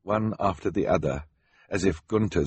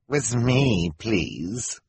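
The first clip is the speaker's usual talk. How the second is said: villain Gunter; 3 semitones higher, much slower than usual. Acted. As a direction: fake foreign accent, drawn out.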